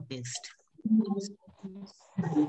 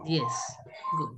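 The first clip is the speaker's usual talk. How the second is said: usual speaking voice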